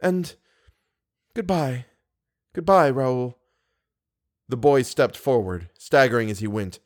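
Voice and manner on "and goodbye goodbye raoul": higher woman's voice, halting between words; grave, changed voice. Acted. Woman bidding Raoul goodbye, grave and changed. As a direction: part gently, pausing before each farewell. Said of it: "grave and changed voice".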